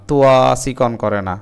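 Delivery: ordinary delivery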